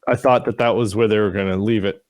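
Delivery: normal talking voice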